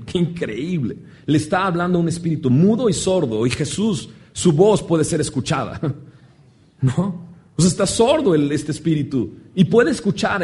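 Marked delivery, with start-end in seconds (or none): none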